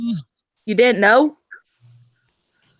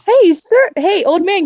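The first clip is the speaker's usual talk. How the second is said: raised, high-pitched hail; calling out; 10 semitones higher, 4 dB louder than usual. Acted. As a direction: call out to get his attention. Player's character calls to wagon owner.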